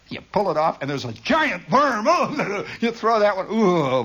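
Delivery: ordinary delivery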